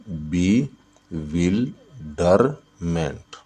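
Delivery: "Bewilderment" slowly as बि, विल, डर, मेंट; four separate beats in "bewilderment", a pause after each; syllable by syllable. Shown as a demonstration. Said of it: "bewilderment में कितने syllable है?". ("Bewilderment" को बि... विल... डर... मेंट, तोड़कर)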